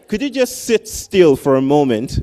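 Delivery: loud, pleading parent's outburst; mock exasperated parent. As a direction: blurt it like a frazzled dad at bedtime. Parent voicing the urge to child.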